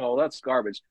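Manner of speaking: ordinary talk, nothing marked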